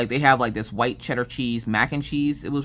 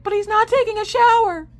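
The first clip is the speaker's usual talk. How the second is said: put-on worried little girl; 6 dB louder than usual. Daughter asking mom about dad, high worried child voice. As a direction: ask like a worried small child.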